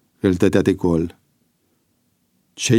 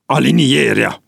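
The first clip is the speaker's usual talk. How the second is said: raised, forceful military order; angry command; 4 semitones higher, 4 dB louder than usual. A narrator's reading. Commander ordering prisoners to line up, angry command. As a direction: order the ranks with anger. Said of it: "porunci el cu mânie".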